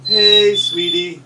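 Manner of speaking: high whistled hey sweetie imitation; chickadee call. Shown as a demonstration.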